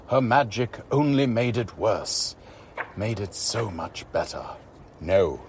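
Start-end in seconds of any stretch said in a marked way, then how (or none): 5.0-5.4 firm. A narrator's reading. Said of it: "Sloane said firmly".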